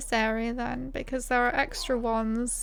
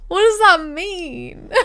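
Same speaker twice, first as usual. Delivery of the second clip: high, laughing disbelief; 8 semitones higher, 10 dB louder than usual. Real, not acted. Streamer amused by the NPC's words, laughing. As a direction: laugh through the question.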